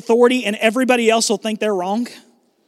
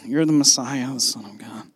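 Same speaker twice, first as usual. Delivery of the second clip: low, very soft murmur; mock timid whisper; 9 semitones lower, 7 dB quieter than usual. Shown as a demonstration. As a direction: say it as if afraid to be heard. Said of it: "Peter didn't just whisper this".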